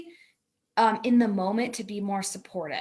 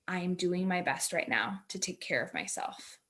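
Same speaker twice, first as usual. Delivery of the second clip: soft affirmation; 3 semitones lower, 7 dB quieter than usual. Shown as a demonstration. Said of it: "a phrase or an affirmation".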